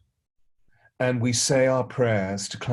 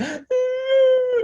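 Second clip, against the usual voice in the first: a sharp, high, startled gasp; child's gasp; 8 dB louder than usual. Retold or imitated. Godson sees him come home, high sharp gasp. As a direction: gasp like the boy did when he saw him. Boy gasps on seeing him.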